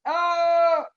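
high, loud wordless wail; wordless cry. Retold or imitated. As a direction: imitate a child's helpless call, held long. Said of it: "He just calls out".

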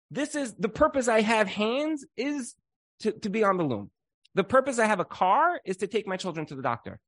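high, bright, beaming with joy; joyful wife. Acted. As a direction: sing out your happiness in the work. Woman voicing joy in her role.

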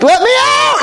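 very high-pitched panicked yell; mock scream. Man locked in with the diseased girl, mock screaming. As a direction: imitate a trapped man screaming to escape. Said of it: "He starts screaming".

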